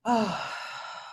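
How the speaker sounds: long, soft audible exhale; settling sigh. Real, not acted. Host settles the group for meditation, long soft sigh. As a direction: let a long breath go to settle in.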